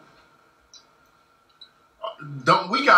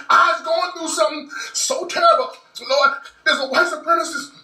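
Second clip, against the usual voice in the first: fake frightened caller; 10 semitones higher than usual. Demonstrating the right way to call the police, hammed-up wailing. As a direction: overplay the terror on a 911 call.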